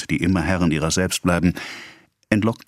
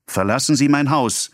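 higher, raised shout; 8 semitones higher than usual. A narrator's reading. Emma ordering mother-in-law out, shouted. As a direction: throw her out with a cry. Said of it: "schrie Emma".

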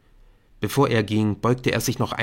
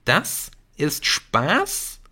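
slow, higher, rising question; hushed disbelief; 5 semitones higher, much slower than usual. A narrator's reading. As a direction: draw it out in disbelief. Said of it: "flüsterte Jamie". (ungläubig und gedehnt gefragt)

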